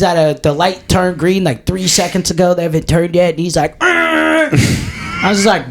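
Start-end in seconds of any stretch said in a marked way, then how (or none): 3.8-4.5 high shouted growl; imitating buddy's road rage yell; mock rage growl. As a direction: roar at the slow driver. Buddy honking, raging at drivers.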